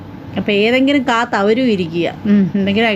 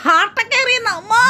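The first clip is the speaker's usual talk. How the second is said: excited squeal; 10 semitones higher than usual. Real, not acted. Reacting to hearts sent on stream.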